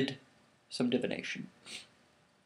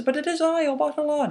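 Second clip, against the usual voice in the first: much higher, insistent plea; pleading protest; 6 dB louder than usual. Acted. Obatala to the guard who won't believe him, high pleading. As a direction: protest in disbelief.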